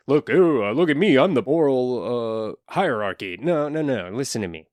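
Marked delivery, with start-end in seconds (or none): 0.1-3.4 exaggerated look-at-me voice; mock moral superiority. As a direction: ham up a self-important boast. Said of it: "I'm just not saying".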